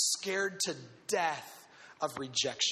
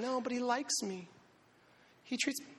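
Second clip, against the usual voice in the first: put-on smitten girl; 6 semitones higher, 4 dB quieter than usual. Lovesick girl defending.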